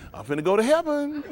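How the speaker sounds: put-on high, rising plea of a doomed kid; comically brace for the end, pitched high; mock dread. Kid expecting a whipping, mock dread. Child braced for a parent's hit.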